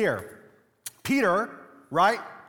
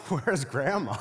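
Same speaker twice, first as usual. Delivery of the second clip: asked through real laughter, lower; the laugh breaks in as it dawns on you; laughing question; 6 semitones lower, 3 dB quieter than usual. Real, not acted. Realizing grandma was forgotten.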